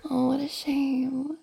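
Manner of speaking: ordinary talk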